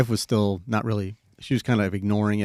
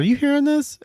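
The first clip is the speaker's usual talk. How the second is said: much higher, alarmed call to the wife; startled; 4 dB louder than usual. Retold or imitated. Father startled, calling out to his wife in a much higher voice. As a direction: jump up high in shock.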